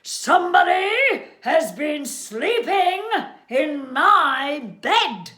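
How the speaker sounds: loud, high-pitched growly bellow; huge outraged Father Bear voice; great big growly bear. Acted. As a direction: roar it out, slowing on the final words. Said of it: "great big growly voice".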